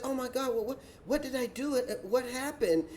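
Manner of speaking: hushed, flustered what-did-I-do act; mock bewilderment. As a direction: imitate a woman baffled and innocent. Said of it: "she says".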